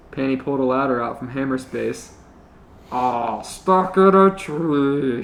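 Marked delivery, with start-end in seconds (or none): 2.9-5.2 slow mocking taunt; mockingly. Panty taunting Chuck stuck up a tree, mocking. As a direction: tease him for being stuck. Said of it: "she said mockingly".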